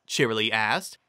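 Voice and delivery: ordinary delivery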